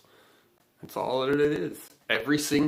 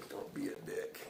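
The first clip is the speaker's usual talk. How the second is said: soft whisper on "Don't be a dick"; 13 dB quieter than usual. Shown as a demonstration. Host whispers the motto as a sign-off line, whispered. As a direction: let "Don't be a dick" fall to a voiceless whisper.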